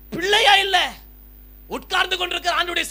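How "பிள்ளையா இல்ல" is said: higher and louder echo of பிள்ளையா இல்ல; stronger cry. Acted. Preacher echoing the widow's loss, a higher, louder ringing cry.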